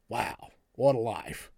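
soft, low, slow musing; acted dreamy awe. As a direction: marvel quietly to yourself, slowly. Voicing an envious cow-watcher's thought.

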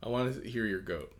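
ordinary talk

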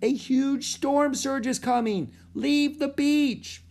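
high, slow, drawn-out shouted warning; urgent shout. A narrator's reading. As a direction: warn a whole beach at full voice. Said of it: "shouted Annie".

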